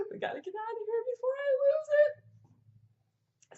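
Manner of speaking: high, slow tearful wail; gasp, then wail it as if about to bawl; mock crying. Mom retelling her near breakdown leaving school, hammed-up crying. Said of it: "I started crying a little bit".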